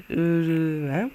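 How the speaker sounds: usual speaking voice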